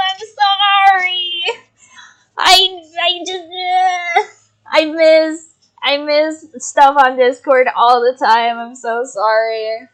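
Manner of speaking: high, loud, wobbly jittery apology; overcaffeinated and overwrought; too much caffeine. Real, not acted. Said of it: "I had two big cups of coffee".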